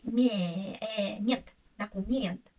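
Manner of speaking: "ме-, э, мент" in pieces, then "документ" with stretched last vowel; stressed е as э. Shown as a demonstration. Teacher breaking the word up, "документ" with long open e. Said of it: "so I say".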